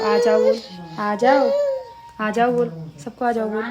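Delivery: loud, high prompting singsong. Real, not acted. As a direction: urge the little one to repeat after you. Prompting toddler to call everyone.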